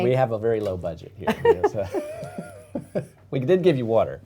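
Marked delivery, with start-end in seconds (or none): 0.0-3.0 laughing. Real, not acted. Host jokes about low budget.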